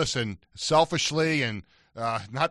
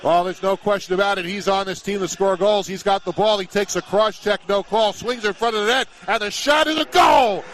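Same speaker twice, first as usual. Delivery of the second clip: play-by-play call; 9 semitones higher, 8 dB louder than usual. Acted. Radio play-by-play goal call.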